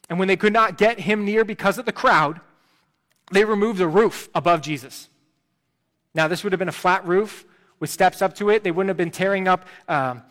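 fervent sermon delivery; preaching. Acted. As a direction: preach it out to the back row. Preaching at full force.

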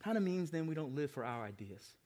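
ordinary talk

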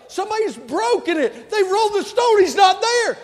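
high, very loud alarmed shouting; frantic shouting. Acted. Messenger bursting in on Pilate about the empty tomb, frantic shouting. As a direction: shout it like an urgent report of disaster.

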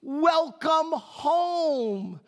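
a lightly voiced sweet wife, drawn out; sweet welcome. Wife greeting husband at the door, mock sweet. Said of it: "she says".

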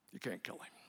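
unvoiced hushed repeat; breathy whispered divine limit. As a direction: whisper the warning. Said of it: "God says to Satan".

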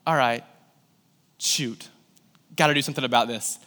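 low, soft mutter; muttered self-talk. Retold or imitated. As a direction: scheme quietly to yourself. Retold uneasy thought to himself.

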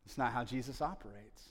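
ordinary talk

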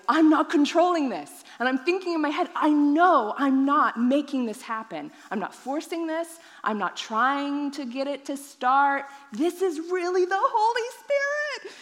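insisting it's real, carried away; overwhelmed. Retold or imitated. Overwhelmed speaking in tongues.

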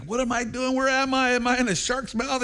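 high, panicked questions; mock panic. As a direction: sound trapped and frightened in the dark. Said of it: "He's like".

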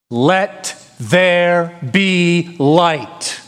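very slow, drawn-out proclamation; solemn declaration. Retold or imitated. Preacher voicing God at creation, slow solemn decree. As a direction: declare it slowly with full weight. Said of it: "when he declared".

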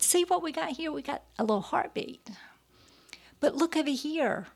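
high sing-song doctor voice; doctor's coy reveal. Retold or imitated. Doctor showing mother the ultrasound, high sing-song reveal. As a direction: lilt it high, like showing off a surprise.

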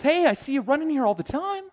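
high, loud chirpy greeting; imitated woman. Imitating woman who greets him at gym.

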